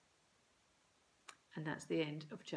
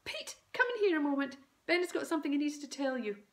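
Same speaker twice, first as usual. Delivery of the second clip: hurried excited mum; 8 semitones higher, 5 dB louder, faster than usual. A narrator's reading. Mum calls Dad, hurried.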